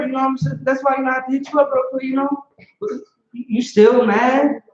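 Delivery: high, pleading wife's voice; a wife wheedling her husband after stabbing him. Retold or imitated. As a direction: sweet-talk him into forgiving you. Wife voiced making excuses.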